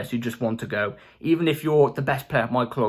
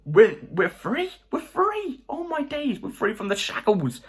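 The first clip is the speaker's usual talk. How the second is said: overjoyed; 7 semitones higher, slower than usual. Real, not acted. Celebrating players leaving the club.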